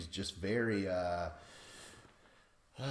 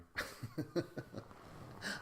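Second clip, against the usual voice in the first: softer, high breath of laughter; guilty laughing; 8 semitones higher, 8 dB quieter than usual. Real, not acted. Podcaster cracking up over sad news, guilty laughter. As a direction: laugh helplessly when you shouldn't. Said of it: "I don't know why I'm laughing".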